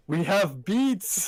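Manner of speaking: slow drawn-out mock line; quoting the video. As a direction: draw it out like the meme video. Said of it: "I watched the Dick Miss".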